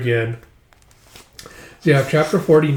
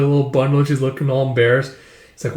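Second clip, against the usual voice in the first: plain reading voice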